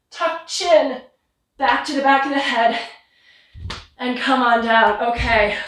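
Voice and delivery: usual speaking voice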